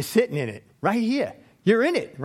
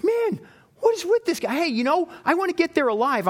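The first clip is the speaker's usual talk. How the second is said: high-pitched, exasperated protest; acted-out indignant complaint to a driver; exasperated passenger; 8 semitones higher than usual. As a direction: protest in a higher, fed-up voice. Passenger protests reckless cab driving.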